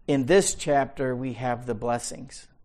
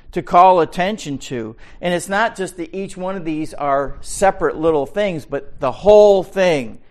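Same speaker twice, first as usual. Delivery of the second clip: loud, higher teaching from the pulpit; forceful preaching; 6 semitones higher, 9 dB louder than usual. Acted. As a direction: press each point home.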